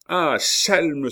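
high, loud burst of fury; furious cry. A narrator's reading. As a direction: cry out the insult in a rage. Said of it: "s'écria Coconas furieux". (cri furieux)

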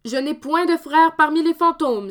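high, loud angry retort; shouting. Acted. Dominique shouting at the fake ghost, loud retort. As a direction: shout him down, blood up. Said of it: "s'écria Dominique".

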